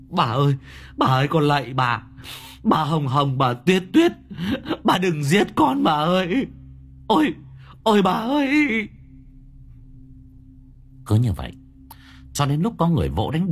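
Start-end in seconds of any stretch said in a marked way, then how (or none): 0.1-8.9 grovel to the ghost; terrified begging. Acted.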